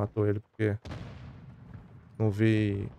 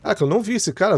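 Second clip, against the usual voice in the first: surprised; 8 semitones higher, 4 dB louder, faster than usual. Real, not acted. Surprised frustration.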